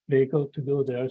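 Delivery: normal talking voice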